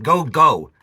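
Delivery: loud order to get out; shouting him out. Real, not acted. Ordering the squatter out.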